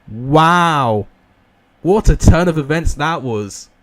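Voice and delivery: loud, drawn-out play-by-play; excited live commentary. Real, not acted. Calling a redeeming penalty save, excited commentary. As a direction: belt out the penalty save for the viewers.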